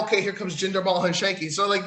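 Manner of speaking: ordinary talk, nothing marked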